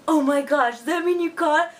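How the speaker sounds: high, loud, gushing disbelief; imitated astonished excitement. Husband realising she got the job, imitated excited astonishment.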